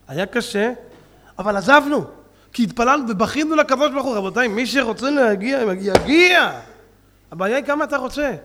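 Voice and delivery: ordinary delivery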